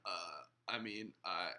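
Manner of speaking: low, quiet, halting mumble; mock hesitant answer. Pastor mimicking a woke claimant caught by a question, hesitant. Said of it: "they'll be like".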